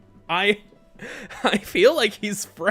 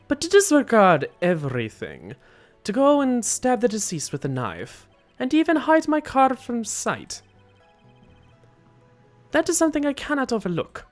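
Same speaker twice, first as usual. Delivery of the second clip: high, emphatic put-on killer voice; indignant accented hitman; 6 semitones higher, 6 dB louder than usual. Killer condemns his client's betrayal, high indignant accented voice. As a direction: protest as an affronted killer with an accent.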